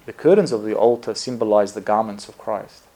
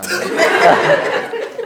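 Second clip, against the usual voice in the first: a short airy burst of real laughter; breathy laugh; 6 dB louder than usual. Real, not acted. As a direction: laugh under your breath. Recalling the bishop's joke.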